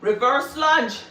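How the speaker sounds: energetic rep-counting cues; workout coaching. Acted. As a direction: call out each move like a trainer. Coaching live lunge reps.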